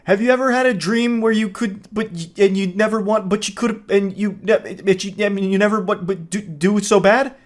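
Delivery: put-on high, stumbling kid voice; flustered kid line reading. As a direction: trip over every word like a nervous kid. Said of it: "that kid who was doing a line reading".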